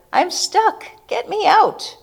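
high, urgent cry for help; high shout. A narrator's reading. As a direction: call out stuck and panicking. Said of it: "then turns to a shout".

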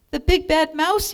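high, loud frightened yell; a child yelling at a huge shadow. Acted. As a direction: shout it out in alarm. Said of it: "yelled the Gruffalo's child".

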